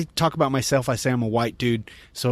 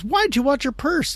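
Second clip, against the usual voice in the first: mimicking wife; 13 semitones higher than usual. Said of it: "she goes".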